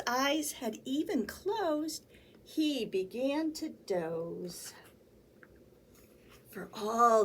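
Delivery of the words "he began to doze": plain reading voice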